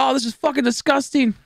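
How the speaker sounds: high, revolted complaint; disgusted. Retold or imitated. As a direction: pitch jumps up in disgust. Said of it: "So I was like".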